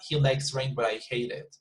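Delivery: emotionless, LIKES and HATE left unstressed; no emotion. Shown as a demonstration. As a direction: say it with no feeling at all. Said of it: "it's like there is no emotion".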